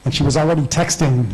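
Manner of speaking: normal talking voice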